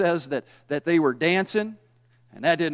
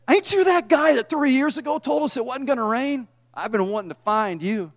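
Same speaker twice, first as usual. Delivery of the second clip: mocking Ahab taunt; 7 semitones higher than usual. Ahab taunting Elijah, paraphrased.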